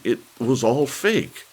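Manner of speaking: blurting out the truth in desperation; desperate confession. A narrator's reading. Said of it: "stopped crying long enough to say".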